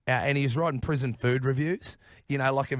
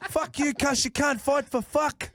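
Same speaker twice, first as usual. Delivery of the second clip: put-on pitched-up Kiwi-accent heckle from the back; 13 semitones higher, slower than usual. Planned Kiwi-accent heckle at Hemo from the dark. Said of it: "with a Kiwi accent".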